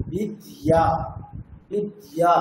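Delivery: বিদ্যা with the y glide kept, as bidiyā; বিদ্যা as bi-diyā, slow and careful; Sanskrit pronunciation. Shown as a demonstration. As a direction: repeat বিদ্যা, letting the y sound through. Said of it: "ইয়, তাহলে".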